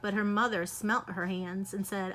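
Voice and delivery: plain reading voice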